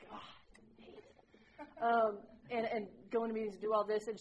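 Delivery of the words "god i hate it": groaning aside. Real, not acted. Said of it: "aside about hated task".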